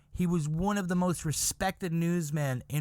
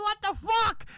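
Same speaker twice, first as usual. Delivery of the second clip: high, squealed disbelief; shrill mock-horrified exclamation; freaked-out outcry; 3 dB louder than usual. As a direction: cry out as if scandalized. Women freaking out in the story.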